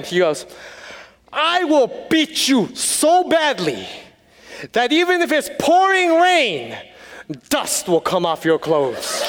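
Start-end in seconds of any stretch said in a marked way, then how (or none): 1.3-9.2 slow, high mock yell, dropping at the end; hammed-up threat, drawn out word by word; yelling elder. Acting out the elderly man's translated threat, mock yelling. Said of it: "he's yelling".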